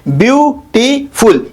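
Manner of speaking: Beautiful slowly in three beats, BYU, TEE, FUL; split into syllables. Shown as a demonstration. Teacher counting syllables for students, slowly split up. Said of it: "इसमें तीन syllable है".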